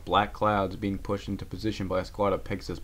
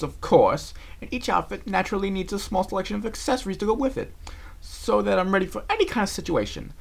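much higher posh lecture; prim explaining; 10 semitones higher, 4 dB louder than usual. Acted. Rarity explains her luggage to Applejack. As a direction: list it like it's obvious.

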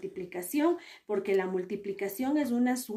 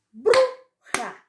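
Bruja split, BRU high and loud; stress on first syllable; 10 semitones higher, 8 dB louder than usual. Shown as a demonstration. Teacher showing the tonic syllable, Bruja with a loud, high BRU. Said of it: "tenía mayor fuerza de voz".

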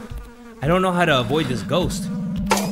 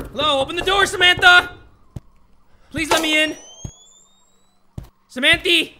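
raised, urgent calling out; high pleading call; 11 semitones higher, 5 dB louder than usual. Pretend. Player begging Samantha to open the door, high pleading call.

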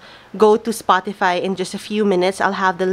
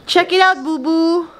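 high, bright cooing to a puppy; 8 semitones higher than usual. Real, not acted. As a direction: coo at the puppy. Showing the puppy balloons.

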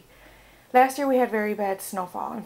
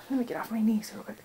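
whispered aside; 7 dB quieter, faster than usual. Real, not acted. Quick aside while getting up.

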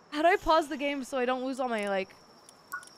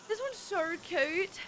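soft, slow, raised cooing; 5 semitones higher, 5 dB quieter, much slower than usual. Real, not acted. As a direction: coo over it like a pet. Cooing at a cute pal.

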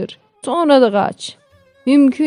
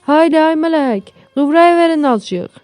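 herding call; 8 semitones higher, 3 dB louder than usual. Acted. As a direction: call the cow along, pitched up. Urging the cow along.

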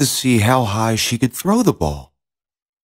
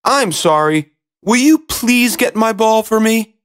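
high-pitched princess plea; high, imploring storybook princess; pleading princess; 10 semitones higher, 3 dB louder than usual. A narrator's reading. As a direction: beg sweetly in a high princess voice.

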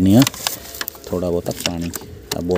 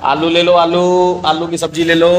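vegetable vendor call; 5 semitones higher than usual. Pretend. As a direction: call out potatoes like a street seller. Husband hawks potatoes like vendor.

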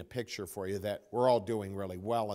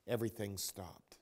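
normal talking voice

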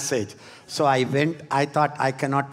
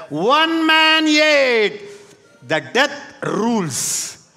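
preaching at full force; 4 semitones higher, slower than usual. Real, not acted. Climactic preaching shout.

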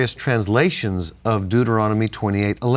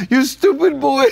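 higher, louder, laughing through a retold scolding; mock angry yell; 13 semitones higher, 5 dB louder than usual. Grandfather yelling at the boy who hit the garage, laughing mock yell. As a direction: imitate grandpa's outburst, cracking up. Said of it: "never got mad at me. Until that day".

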